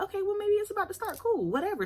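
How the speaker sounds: high, airy shrug of a voice; breezy dismissal. Retold or imitated. To herself about her period, breezy and high. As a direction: brush it off lightly, way up high. Dismissive inner thought quoted.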